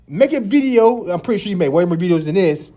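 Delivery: ordinary talk, nothing marked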